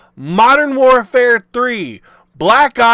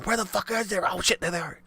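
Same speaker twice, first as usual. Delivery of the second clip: hiding whisper; 2 semitones lower, 13 dB quieter, much faster than usual. Retold or imitated. Peeking out of a trash can.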